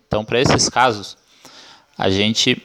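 normal talking voice

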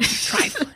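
"Trifling" high and drawn from the chest; high-pitched, relished demo of "Trifling". Shown as a demonstration. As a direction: put your chest into "Trifling". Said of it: "We say it with our chest".

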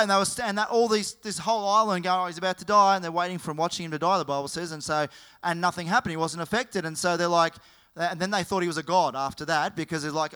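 loud, driving sermon voice; full-force preaching. Acted. Pastor rousing the congregation with a loud preaching voice. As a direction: preach it hard to the room.

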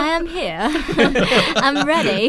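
giggly self-promotion; laughing. Real, not acted. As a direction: laugh through a playful pitch. Joking job pitch, laughing.

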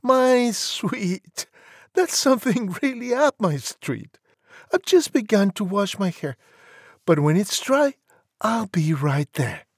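put-on high, breezy young lady on the phone; high, assured girlish voice. A narrator's reading. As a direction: chat confidently in a falsetto. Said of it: "she said".

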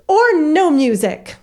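high, slow beaming announcement; a teacher thrilled with her new idea; proud, delighted. Retold or imitated. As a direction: say it proud of yourself. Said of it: "She goes".

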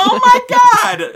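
high, loud, slow scandalized cry; mock exasperation. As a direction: wail it like a scandalized snob. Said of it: "and people are like".

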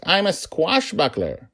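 put-on high, proud child's voice; gleeful daughter declaring herself; playful, high and loud; amused little girl. A narrator's reading. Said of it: "she laughed".

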